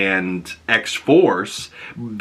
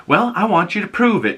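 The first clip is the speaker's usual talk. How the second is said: much higher, prim challenge; challenging boss; 10 semitones higher than usual. Retold or imitated. Doctor Cooper challenges Polaris in a much higher, prim voice. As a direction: dare her to prove it, primly. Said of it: "and Doctor Cooper's like".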